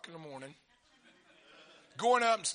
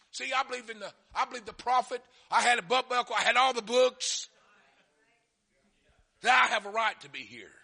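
raised, building self-justification; insistent believer; 5 semitones higher, 6 dB louder than usual. Acted. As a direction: plead the case with mounting force. Acts out churchgoer insisting on entry.

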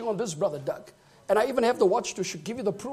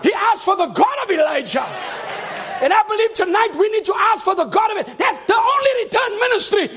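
loud, high, shouting preacher; preaching; 8 semitones higher, 4 dB louder than usual. Acted. Preacher shouting to the church about the God of Elijah. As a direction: preach it hard to the congregation.

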